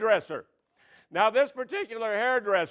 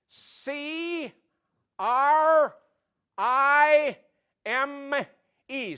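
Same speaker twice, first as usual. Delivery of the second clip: higher, letter by letter; contestant spelling; 6 semitones higher, much slower than usual. Pretend. Contestant spelling the punchline, higher. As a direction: spell each letter deadpan.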